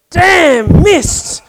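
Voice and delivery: God curses the missed lightning bolt, loud and high; voice from heaven. Retold or imitated. God's voice from heaven.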